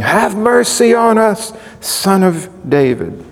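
high-pitched cry for mercy. Retold or imitated. Preacher quotes two blind men following Jesus, crying out. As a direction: call out pleading, starting high and easing off. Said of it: "crying out".

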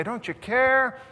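high, loud desperate plea; panicked cry. Acted. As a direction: cry out like drowning men. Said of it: "they're freaking out".

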